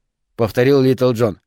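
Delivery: usual speaking voice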